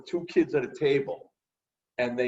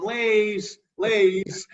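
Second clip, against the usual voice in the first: street vendor call; 9 semitones higher, 5 dB louder than usual. Retold or imitated. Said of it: "standing on the corner going".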